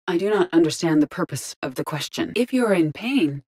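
ordinary talk

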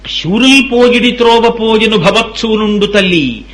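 slow, drawn-out verse chant; verse recitation. Acted. Preacher quotes verse consoling a grieving mother, slow recitation. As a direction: intone it as a sung-out verse. Quoting a scripture verse to listeners.

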